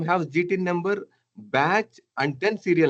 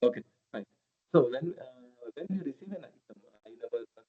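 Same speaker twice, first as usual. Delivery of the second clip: barely audible; 3 semitones lower, 9 dB quieter, faster than usual. Real, not acted. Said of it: "Your voice is very low and very far away".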